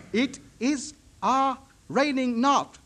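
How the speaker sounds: slow, word by word, broken English; halting, laboured repeat of a foreigner's words; repeating slowly. Retold or imitated. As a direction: piece the sentence together with gaps between the words. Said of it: "Say it slowly".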